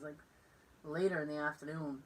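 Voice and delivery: ordinary delivery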